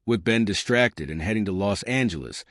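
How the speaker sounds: plain reading voice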